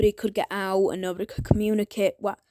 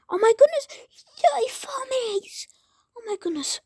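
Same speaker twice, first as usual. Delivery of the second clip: shocked exclamation; 5 dB louder than usual. Real, not acted. Streamer sees it has been thirty-four minutes, high startled squeal. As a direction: squeak it in real surprise, tailing off. Notices how long they have talked.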